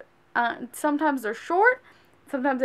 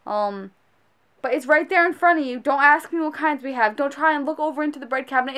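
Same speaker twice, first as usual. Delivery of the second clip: ordinary delivery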